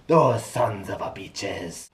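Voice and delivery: mock Spanish accent, slow and drawn out; a hammed-up Spanish accent cursing the arsonists. Reviewer cursing the fleet burners in a mock Spanish accent. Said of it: "I broke into a Spanish accent there".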